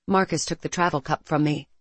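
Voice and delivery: ordinary delivery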